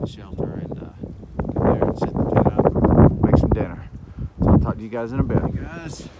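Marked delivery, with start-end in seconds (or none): 1.5-5.4 speaking over strong wind; words half lost in gusts; wind in the face. Real, not acted. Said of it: "sorry about the wind". 5.4-6.1 talking into a gusty wind; raised voice over wind noise; wind in the face. Real, not acted. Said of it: "sorry about the wind".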